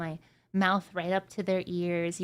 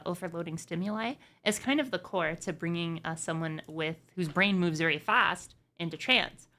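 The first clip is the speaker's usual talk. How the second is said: usual speaking voice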